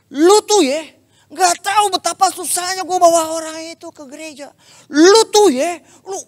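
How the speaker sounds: high, loud scolding friend; angry scolding. Retold or imitated. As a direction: scold him like a furious friend. Teman marah-marah menegur pembicara. (teman memarahi dengan suara tinggi dan keras)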